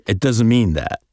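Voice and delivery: normal talking voice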